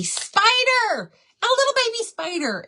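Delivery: high squeal of creeped-out horror; mock horror. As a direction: squeal it like a scary reveal. Squealing climax of spider story.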